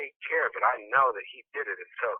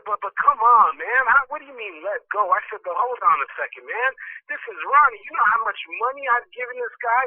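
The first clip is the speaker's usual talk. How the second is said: higher, louder exasperated pleading; indignant protest; 7 semitones higher, 3 dB louder than usual. Retold or imitated. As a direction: argue back in disbelief. Acted protest at advice to let go.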